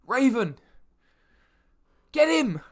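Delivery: rally the crowd to attack; angry townsfolk yelling for the hero's capture; angry mob shouting. Acted. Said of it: "they start shouting".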